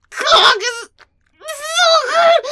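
high, moaning cry; ecstatic cry. Acted. Wife overcome on the phone, crying out.

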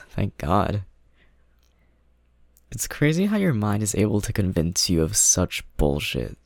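usual speaking voice

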